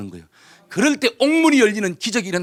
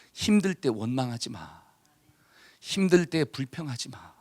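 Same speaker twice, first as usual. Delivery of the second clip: slow, soft, lowered pleading; gentle admonition; 4 semitones lower, 6 dB quieter, slower than usual. Acted. Preacher voicing scripture to those struggling, softly and slowly admonishing. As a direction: counsel softly, drawing each phrase out.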